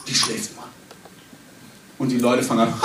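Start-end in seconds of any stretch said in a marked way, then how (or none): 0.1-0.6 quietly breathed, unvoiced; whisper. Jesus telling mourners the girl sleeps, whispered. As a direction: hush it like a secret.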